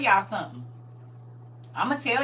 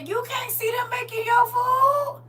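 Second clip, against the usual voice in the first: indignant shout; 14 semitones higher than usual. Real, not acted. Indignant about rude diners.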